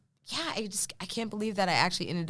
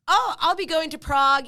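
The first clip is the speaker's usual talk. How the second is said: a grand, higher and louder improv answer; mock-confident; 10 semitones higher, 8 dB louder than usual. Playing a touring singer on a talk show, grandly. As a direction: announce it like a touring star. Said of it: "And I was like".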